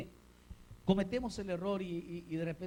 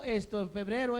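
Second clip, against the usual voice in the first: ordinary delivery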